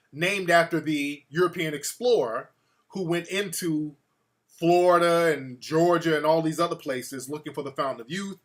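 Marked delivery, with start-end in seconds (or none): none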